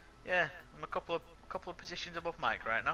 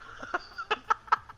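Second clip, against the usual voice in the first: breathy laugh. Real, not acted. Teammate laughing at disqualification.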